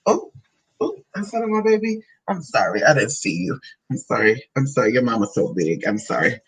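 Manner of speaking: low, soothing apology to a little one; tender apology. Real, not acted. Apologizing to baby for not seeing them, tender.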